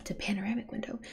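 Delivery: hushed aside, barely voiced; whisper. Real, not acted. Streamer whispers an aside while reading the game. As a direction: whisper it like a secret.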